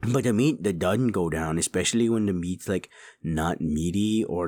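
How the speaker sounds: ordinary delivery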